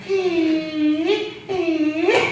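high, soft ghost-like 히; a high, soft eerie 히 like a haunting; ghostly hee. Shown as a demonstration. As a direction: make 히 sound like a ghost is coming. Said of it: "귀신 나올 소리가 나".

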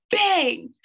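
high, punched-out sound word; slamming-door bang. A narrator's reading. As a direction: make the door slam with your voice. Narrator imitates a slamming door.

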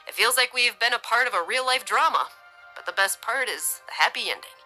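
relief showing through, lilting; relieved. A narrator's reading. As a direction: let the relief slip out. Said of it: "couldn't hide his relief".

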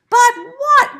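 high, loud, impatient demand; growling. A narrator's reading. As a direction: burst out as an exasperated fox. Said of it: "growled the fox".

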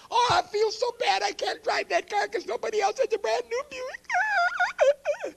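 high, hammed-up fake sympathy over a new car; sing-song gloating, pretending to feel sorry; mock bragging. Preacher mocking his own brag about the new Buick, high and hammed-up.

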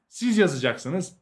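ordinary talk, nothing marked

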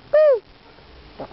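high, loud squeal of delight; excited whoop. Real, not acted. Kid showing her toy collection, giving a high excited whoop. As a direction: cheer as if the toy just won.

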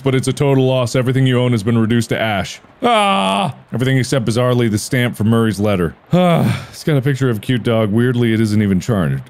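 ordinary talk, nothing marked

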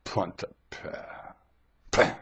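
Punt-a-pah breathed out slow and whispered; menacing whisper. Retold or imitated. Imitating the friend walking over to stab someone, slow whisper. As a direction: whisper it like you're about to strike. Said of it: "when you're ready to stab somebody".

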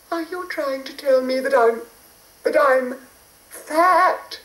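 high hurried fairy; a sweet high fairy in a rush. A narrator's reading. Fairy indignant.

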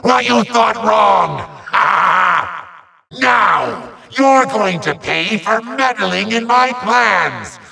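usual speaking voice

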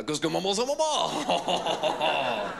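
high, slow French-sounding gibberish; exaggerated imitation of French babble; mock French noises. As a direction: fake French with a long drawn-out ending. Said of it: "I'd just make French noises".

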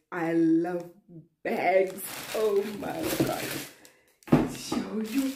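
loud, thrilled gush; excited. Real, not acted. Finding a bag in the gift box, loud and thrilled.

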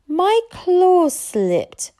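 slow, high dragon whine; whining. A narrator's reading. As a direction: whine a drawn-out excuse. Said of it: "whined Hook Fang".

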